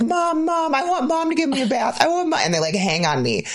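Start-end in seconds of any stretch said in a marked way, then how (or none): 0.0-2.4 put-on much higher eager kid voice; kids begging for Mom. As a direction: plead like little kids wanting Mom. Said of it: "they're like".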